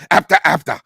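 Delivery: hint of a stern old master; Mr. Miyagi voice. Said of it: "he's like".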